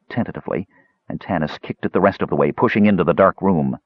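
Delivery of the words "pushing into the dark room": plain reading voice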